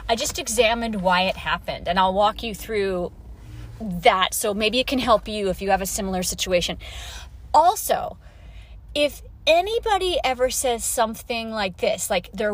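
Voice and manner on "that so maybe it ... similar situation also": ordinary delivery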